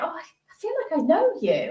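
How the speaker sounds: high, quick retold gush; retold sudden recognition. Woman recalls telling a new acquaintance he seemed familiar, gushing recognition. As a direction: rush it out, rising high on the realisation. Retelling her instant recognition of him.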